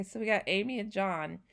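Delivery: usual speaking voice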